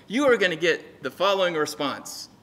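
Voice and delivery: ordinary talk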